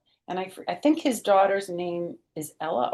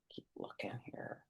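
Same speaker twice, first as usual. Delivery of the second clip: whisper; 22 dB quieter than usual. Real, not acted. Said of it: "whispered aside".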